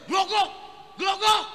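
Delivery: loud, high mock burp sound; burping after the scraping; imitated burp. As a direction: belch it out.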